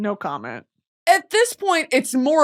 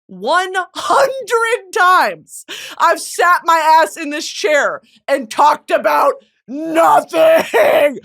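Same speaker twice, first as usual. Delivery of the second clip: loud, slow, drawn-out proclamation; celebrating hundredth episode; 6 semitones higher, 4 dB louder, much slower than usual. Real, not acted. Hosting the hundredth episode, she loudly proclaims it. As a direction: shout it out like a triumphant milestone. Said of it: "one zero zero".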